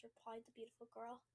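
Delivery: ordinary delivery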